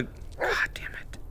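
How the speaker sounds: unvoiced frightened whisper. Real, not acted. Streamer whispering nervously during the horror game. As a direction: whisper tensely while sneaking.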